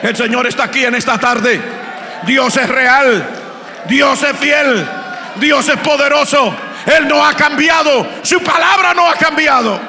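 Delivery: loud, high shouted proclamation; full force preaching. Real, not acted. Preacher shouting God's deliverance to the congregation, full force. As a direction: preach it with all your might.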